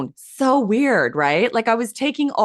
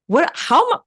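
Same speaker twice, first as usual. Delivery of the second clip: retold disbelief; 10 semitones higher than usual. Shocked at a divine assignment.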